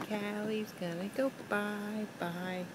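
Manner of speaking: put-on softly, slowly to a toddler. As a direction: hush it gently and slowly. Repeated much softer to toddler.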